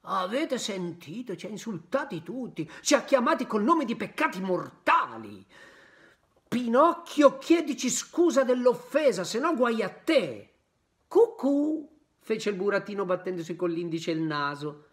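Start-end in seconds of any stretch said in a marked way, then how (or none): 0.0-10.5 put-on raised, threatening boys' voices; bully him, demand an apology loudly; angry schoolboys. 11.1-11.9 high teasing "Cucù"; mocking cuckoo. Pinocchio mocking the boys.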